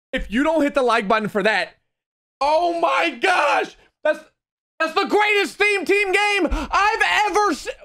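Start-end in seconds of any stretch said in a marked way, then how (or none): 2.4-7.7 drawn-out shout of amazement; excited disbelief. Real, not acted. Gamer telling viewers about the comeback, excited disbelief. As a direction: still can't believe what just happened. Still overwhelmed after the win.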